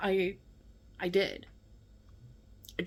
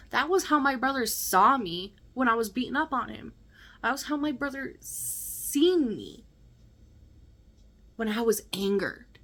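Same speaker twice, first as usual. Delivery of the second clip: ordinary talk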